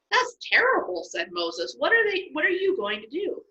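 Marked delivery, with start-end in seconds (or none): 0.1-1.0 much higher, alarmed gasp of dismay; shocked. Acted. Moses shocked by the news. 1.8-3.4 much higher, worried questioning; alarmed. Acted. Moses asks God in alarm.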